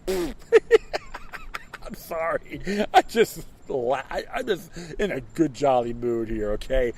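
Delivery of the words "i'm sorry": high, very slow laughing "sorry"; laughing apology. Real, not acted. Host apologizes for his Rubio joke, high laughing sorry. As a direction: giggle the apology out.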